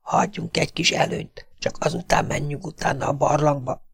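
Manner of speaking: soft, hushed voice; hushed plotting in a cave; whispered warning. A narrator's reading. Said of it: "súgta Corner".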